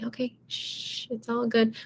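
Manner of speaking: soft hush, calming the barking chihuahua; hushing a dog. Pretend. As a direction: shush it gently like a yapping pet in a purse. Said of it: "you can say".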